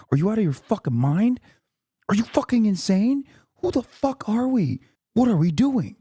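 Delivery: high strained scream kept hushed for the neighbor; whisper scream. Real, not acted. Ranting at chat at night, a hushed whisper scream. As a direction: yell it high but hold the volume down. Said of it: "I have to whisper scream".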